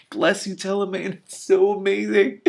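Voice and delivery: thrilled at the villain's fall; delighted gush. Real, not acted. Reader delights at Telemain's trip.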